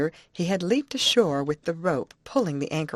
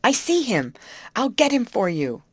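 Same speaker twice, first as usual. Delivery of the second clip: raised, higher voice calling back; high, loud reply across water; nervous call; 6 semitones higher, 5 dB louder than usual. Acted. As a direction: call over with nervous eagerness. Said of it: "he called in a quick, nervous manner".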